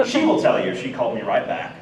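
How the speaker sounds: usual speaking voice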